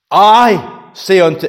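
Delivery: "I" drawn out high and loud; stress on I. Shown as a demonstration. Preacher showing where the Lord's authority is, stress on I. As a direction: hit the word "I" hard, slowly. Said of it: "puts the emphasis on the word".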